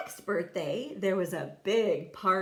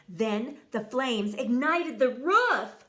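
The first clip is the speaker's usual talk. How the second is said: plain reading voice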